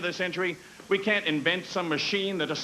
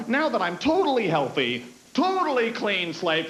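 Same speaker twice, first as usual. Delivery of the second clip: ordinary talk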